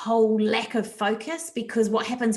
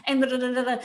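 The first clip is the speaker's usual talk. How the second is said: stressed racing thoughts; 3 semitones higher, much faster than usual. Retold or imitated. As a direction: rush through the list like a frazzled parent.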